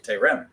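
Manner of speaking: Terem high and loud, weight on the end; stress on last syllable. Shown as a demonstration. Teacher models the non-segolate, Terem stressed on the end. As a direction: lift and hit the second syllable of Terem. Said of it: "it's no longer a seglet".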